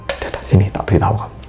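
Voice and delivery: quick, hushed whisper; fast conspiratorial undertone; confiding whisper. Acted. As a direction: whisper them closer to share a secret. Senior confides a secret to junior.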